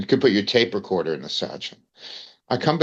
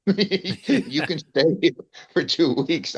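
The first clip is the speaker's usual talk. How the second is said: laughing; 6 semitones higher, slower than usual. Real, not acted. Laughing while retelling welcome.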